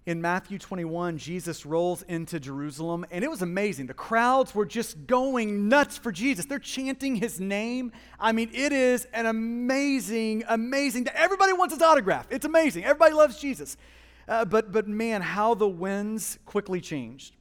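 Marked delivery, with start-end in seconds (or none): 3.8-13.8 louder, higher gush of amazement; excited crowd hype. Retold or imitated. Preacher relives the crowds going nuts for Jesus, excited. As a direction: build to a rapid, high, loud rave.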